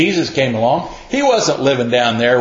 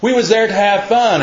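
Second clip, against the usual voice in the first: put-on kids yelling out; 10 semitones higher than usual. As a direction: call out like kids in the marketplace. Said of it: "the little kids are yelling out to them".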